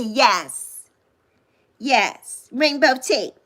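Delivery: usual speaking voice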